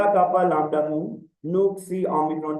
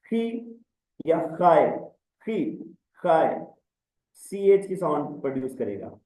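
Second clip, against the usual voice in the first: normal talking voice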